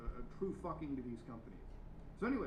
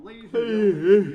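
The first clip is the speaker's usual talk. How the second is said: loud announcer; 6 semitones higher, 22 dB louder than usual. Acted. Big mock announcer opening.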